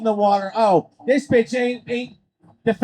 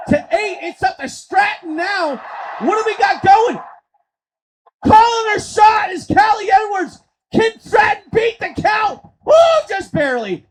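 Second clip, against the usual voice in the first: high, loud count-out call; urgent ringside commentary; excited play-by-play; 9 semitones higher, 5 dB louder than usual. Acted. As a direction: shout the suspense of a near count-out.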